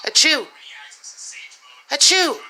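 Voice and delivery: loud, high fake sneeze; hammed-up loud sneeze. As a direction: put on a big sneeze to spook the cat. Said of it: "Watch this".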